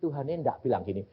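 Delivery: usual speaking voice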